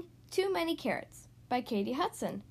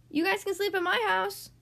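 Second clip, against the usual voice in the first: higher, lightly called-out offer; calling out; 7 semitones higher, 5 dB louder than usual. Acted. Beaver calling to homeless friends, inviting them in. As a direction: call it over cheerfully, without shouting. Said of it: "called Beaver".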